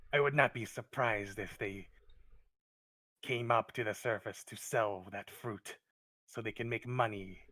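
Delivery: forceful, halting phrases with loud bursts; distrustful kobold. Acted. Kobold warns the visitor about hired killers, emphatic distrust. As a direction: stand your ground as a suspicious guardian. Game master voices kobold.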